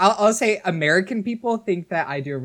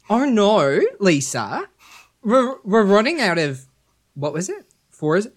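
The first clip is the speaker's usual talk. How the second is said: put-on a lightly done Australian accent, a bit higher; bad Australian accent; 4 semitones higher, 4 dB louder than usual. Guest tries the test sentence in an Australian accent. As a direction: fumble through it in an Australian accent. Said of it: "say in your accent".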